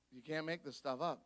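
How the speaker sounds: normal talking voice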